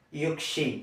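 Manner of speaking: Finnish యుక్షి, softly repeated; Finnish word. Shown as a demonstration. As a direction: say యుక్షి as yukshi, quietly. (Finnish ఉచ్చారణ యుక్షి)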